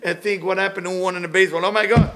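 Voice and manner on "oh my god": mock husband. Husband reacting to baseball scores.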